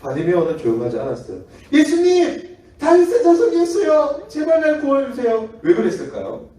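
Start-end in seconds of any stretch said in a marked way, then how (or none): 1.7-5.5 high, loud desperate cry. Acted. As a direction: shout for Jesus with everything. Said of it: "그렇게 외치는".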